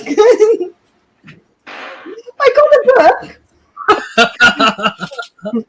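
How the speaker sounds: words breaking up into real laughter; laughing. Real, not acted. Woman admitting she can't remember her book's subject, laughing. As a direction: crack up while trying to finish. Cracking up over forgotten book.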